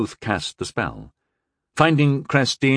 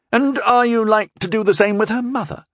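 accusing; 13 semitones higher, 4 dB louder than usual. A narrator's reading. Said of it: "Rondell asked accusingly".

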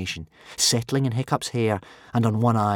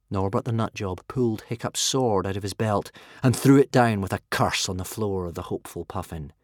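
ordinary delivery

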